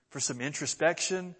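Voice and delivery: normal talking voice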